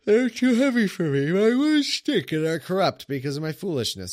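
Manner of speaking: loud, high mock wail of anguish; hammed-up lament. Streamer hamming up the psalmist's lament, loud mock wail. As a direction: overplay the sufferer crying out.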